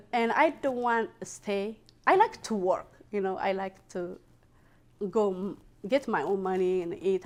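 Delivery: ordinary talk, nothing marked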